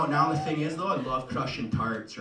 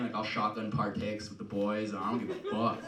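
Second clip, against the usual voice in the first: ordinary delivery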